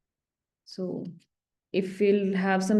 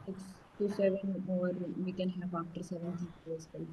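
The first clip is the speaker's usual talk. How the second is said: faint, low-volume voice on the call; speaking too softly into the mic; barely audible; 10 dB quieter than usual. Real, not acted. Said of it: "Your voice is very low".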